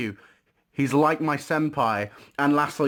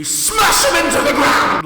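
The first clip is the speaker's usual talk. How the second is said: loud, higher-pitched shout on the smash; shouted outburst; 14 semitones higher, 11 dB louder, slower than usual. Acted. Telling viewers to crush the victim's hopes, shouted. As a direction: roar it like slamming them down. Emphatic violent outburst mid-tip.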